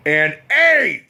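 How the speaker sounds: loud, high punch on the last word; defiant punchline. Acted. Cook taunting the hillbilly customer, defiant punchline.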